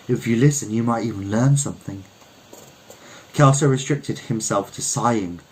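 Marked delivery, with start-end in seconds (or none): none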